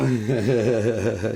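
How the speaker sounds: loud cackle amid screaming; loud laugh. Real, not acted. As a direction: burst out laughing at full volume. Laugh between screamed insults.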